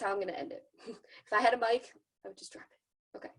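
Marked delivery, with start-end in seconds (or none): 2.2-3.3 whispered, playful mic drop; silly whisper. Pretend. Ending her training with a playful whispered mic drop. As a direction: breathe it out like a joke. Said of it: "I'm just being silly".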